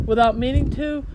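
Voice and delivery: ordinary delivery, nothing marked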